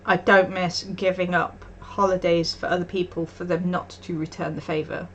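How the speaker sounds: ordinary talk, nothing marked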